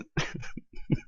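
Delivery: soft, wheezy laugh; real breathless laughter. Real, not acted. Host unable to finish the channel plug, breathless laughter. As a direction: let a quiet laugh escape, out of air. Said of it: "I'm out of breath from laughing".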